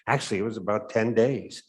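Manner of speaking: usual speaking voice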